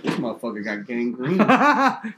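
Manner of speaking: slow words broken by laughter; laughing mock quote. Imagining the doctor's reaction, slow and laughing. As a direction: drag it out while cracking up. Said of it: "She's like".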